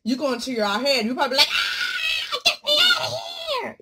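high, loud inner scream with a laugh; laughing mock shout; mock panic. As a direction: cry out to escape your own head. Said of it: "you're like".

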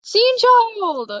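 high, loud, delighted shout; excited call. Real, not acted. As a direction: call out excitedly to a favorite character. Calls out on spotting Scene Child.

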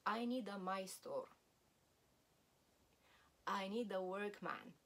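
usual speaking voice

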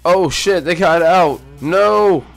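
high, loud dismay; alarmed cry. Real, not acted. Gamer dismayed the chickens escaped.

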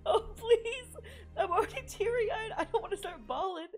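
loud, high, laughing through tears; close to tears. Real, not acted. Viewer moved by Mikasa, laughing on the verge of tears. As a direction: fight off crying with laughter. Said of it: "I'm gonna start crying".

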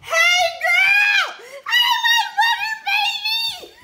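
high, loud laughing greeting; excited shout. Real, not acted. Friend walks in, loud laughing excited hello. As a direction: call out to her with joy.

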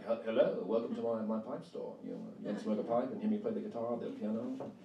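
hammed-up British shopkeeper accent, fast in the middle; a teen showing off in a cheesy British accent; corny British accent. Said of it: "like, corny British accent".

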